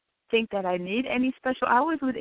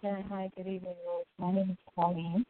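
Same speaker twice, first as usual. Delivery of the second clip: muffled; 2 semitones lower, 8 dB quieter than usual. Real, not acted. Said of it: "you sound muffled".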